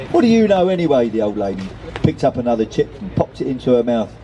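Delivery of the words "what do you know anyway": high start, tumbling down dismissively; higher-pitched, mildly indignant comeback; sharp retort. A narrator's reading. Old lady answers her daughter back, mildly indignant.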